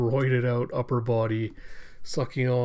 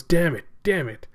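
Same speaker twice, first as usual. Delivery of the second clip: raised, louder angry outburst; frustrated cursing; 5 semitones higher, 5 dB louder than usual. Acted. Ito throws clothes into the river, frustrated cursing. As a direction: curse in acted frustration.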